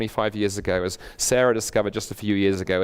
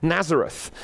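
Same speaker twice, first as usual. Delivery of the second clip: higher-pitched snobbish dismissal; mock dismissive; 6 semitones higher than usual. Preacher voices prejudiced Nathanael dismissing Jesus, mock dismissive. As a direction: write him off like a snob. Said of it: "he tried to dismiss Jesus".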